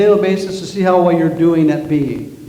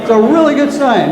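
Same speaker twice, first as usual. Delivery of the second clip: excited; 8 semitones higher, 5 dB louder than usual. Real, not acted. Sermon emphasis, rising excitement.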